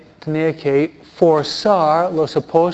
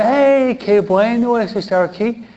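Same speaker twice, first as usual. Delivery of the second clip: much higher and louder, delighted gush; excited Peter; 8 semitones higher, 4 dB louder than usual. Retold or imitated. Preacher voicing Peter, thrilled to be there: excited. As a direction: burst out in delight at the mountaintop.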